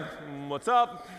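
slow, understated acted greeting; awkward mock greeting. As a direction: draw out an awkward hello.

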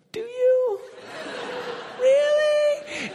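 squeaky falsetto disbelief; hammed-up shaken falsetto; very high voice. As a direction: ask it in a shocked, sky-high squeak. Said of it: "my voice went up that high".

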